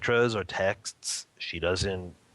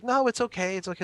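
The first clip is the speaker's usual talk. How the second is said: high, light reassurance in a woman's voice; woman's reassuring voice; 12 semitones higher than usual. Retold or imitated. The woman with the iPad reassuring the father, high and light. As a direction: wave off the worry, sweetly. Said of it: "the person's like".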